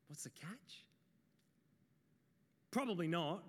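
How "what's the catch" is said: hushed, breathy what's the catch; whispered suspicion. Retold or imitated. Doubting shepherd whispering to his mates about the angel. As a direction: whisper it like you suspect a trick.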